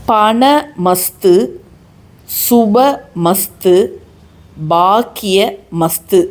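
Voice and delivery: slow, spaced-out chant words; deliberate word-by-word demonstration; measured recitation. Shown as a demonstration. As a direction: recite each word slowly, with long pauses. Presenting the three words to chant.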